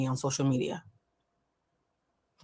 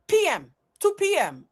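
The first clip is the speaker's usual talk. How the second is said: put-on high, slow Russian accent; poor Russian accent, pitched up and falling; 9 semitones higher, 4 dB louder than usual. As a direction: insist in a mock Russian accent. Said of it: "my poor rendition of a Russian accent".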